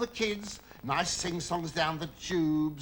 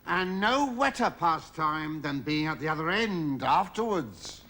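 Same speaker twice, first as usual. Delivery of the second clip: ordinary talk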